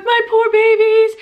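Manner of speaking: high, loud and slow, a hammed-up pitiful wail; mock weepy doting. Vlogger mocking her own hormonal worry about her kids, hammed-up high loud wail. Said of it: "I'm like".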